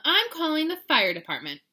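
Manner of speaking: loud, high exasperation; exasperated groan. A narrator's reading. Mama threatens to call for help, exasperated. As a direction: announce it like a fed-up mother. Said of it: "groaned Mama".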